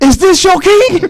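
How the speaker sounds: high, loud, slow mock taunt; mocking Drago taunting the crowd over the fallen fighter. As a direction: ham up a gloating fighter's taunt. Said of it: "looked and said".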